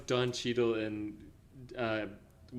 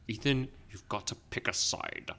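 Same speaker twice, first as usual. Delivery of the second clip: quieter, lightly imitated movie spy boss; Kittredge impression; 3 semitones lower, 8 dB quieter than usual. Host imitating Kittredge in the trailer, lightly put-on ominous voice. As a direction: lightly mimic a film character's measured threat. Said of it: "I just love the way he talks".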